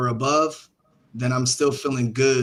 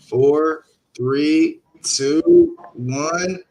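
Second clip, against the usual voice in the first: louder, tense countdown to zero; 3 semitones higher, 6 dB louder, much slower than usual. Real, not acted. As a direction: count down the last seconds. Live countdown on a trade.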